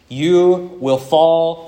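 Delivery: solemn stretched warning; slow emphatic warning. Shown as a demonstration. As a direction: drag out each word like a prophecy. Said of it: "You can't emphasize anything more than that".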